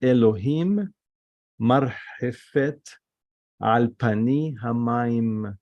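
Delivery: slow Hebrew from "Elohim" to "vehi or", word by word; demonstrated pronunciation. Shown as a demonstration. Teacher reading the verse for students, slow careful pronunciation. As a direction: read "Elohim marhefet al pani hamaim" slowly, with pauses. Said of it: "hear my pronunciation".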